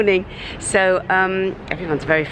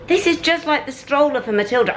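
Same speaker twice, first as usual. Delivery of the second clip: screeching; 7 semitones higher, faster than usual. Real, not acted. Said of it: "I'm sorry I'm screeching".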